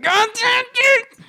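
high-pitched outraged cry; a high retold yelp at the parking price; shocked exclamation. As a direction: yelp it out in disbelief. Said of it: "I'm over here like".

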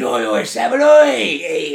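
high, loud call out, peaking on the last oi; mock shout from the cell door; imitated yelling. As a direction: bellow across the landing to a mate. Imitating cellmate yelling across landing.

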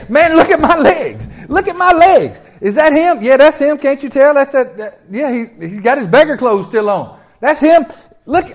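healed beggar shouting with laughing joy; overjoyed. Acted. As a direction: show off your new legs, giddy. Healed man shows his legs.

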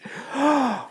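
high gasp of a man being born again; sudden intake of breath in awe; imitating a startled gasp; sharp gasp. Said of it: "he went like this".

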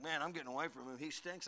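low, soft grumble of disgust; muttered aside. Retold or imitated. Hunter complaining about a smelly buddy at camp, low and soft. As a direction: mutter it so he can't hear. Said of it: "You're like".

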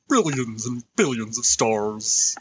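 slow, drawn-out accent attempt; attempted character accent. Game master tries out an accent, slow put-on voice. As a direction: try out a put-on voice, slowly. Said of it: "I'll try that".

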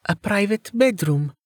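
high, suggestive female character voice; teasing innuendo in a high voice; blatantly suggestive. A narrator's reading. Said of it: "Amelia's blatantly suggestive tone".

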